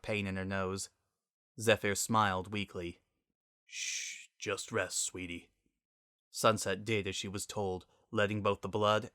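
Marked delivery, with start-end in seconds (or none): 3.6-5.5 whispered, much softer, fading to near nothing; tender hush. Acted. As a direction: soothe a hurt child to rest. Father comforts injured daughter.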